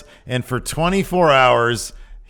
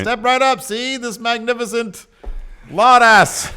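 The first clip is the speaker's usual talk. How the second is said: much higher, loud showman call; carnival barker; 11 semitones higher, 6 dB louder than usual. Pretend. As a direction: hype the crowd like a sideshow barker. Barker presenting a wrestler.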